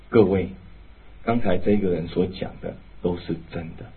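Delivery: soft, low, composed confession; quietly steady before the whole church; calm testimony. Retold or imitated. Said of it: "他不动声色". (平静温和地向会众作见证)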